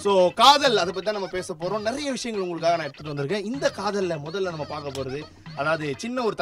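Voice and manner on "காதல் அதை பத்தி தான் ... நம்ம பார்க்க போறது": ordinary talk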